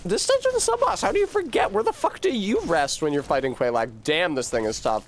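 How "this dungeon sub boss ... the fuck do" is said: much higher cartoon squeak; squeaky silly voice. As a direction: squeak it in disbelief. Sudden very high silly voice.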